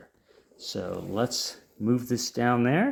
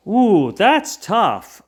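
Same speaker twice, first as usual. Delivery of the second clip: drawn-out surprised ooh; 8 semitones higher, 7 dB louder, much slower than usual. Real, not acted. Reacting to a hard matchup.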